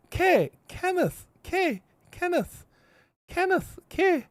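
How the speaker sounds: high, isolated "K" matched to the start of "Kenneth". Shown as a demonstration. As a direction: demonstrate "K" slowly beside "Kenneth", syllable apart. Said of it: "That's how you pronounce the word Kenneth".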